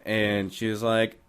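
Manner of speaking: normal talking voice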